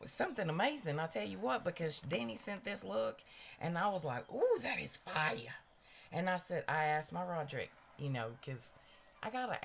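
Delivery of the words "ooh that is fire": impressed ooh. Retold or imitated. Reacting to a fire makeup look.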